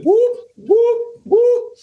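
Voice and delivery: high, quick whoops while shoving through; high whoop noises. Retold or imitated. Pushing through the crowd to find his wife, high whoops.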